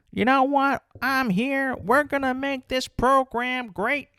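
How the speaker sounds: high-pitched mock Southern rally speech; hammed-up Southern coach addressing a crowd; attempted Southern accent. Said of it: "my best accent that I could possibly do for a Southern person".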